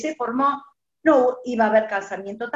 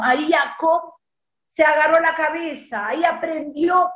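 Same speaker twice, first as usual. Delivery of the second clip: ordinary talk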